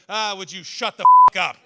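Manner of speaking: loud angry snap at a honking car; angry yell. Retold or imitated. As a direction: yell it at the driver in fury. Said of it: "put up the middle finger and said".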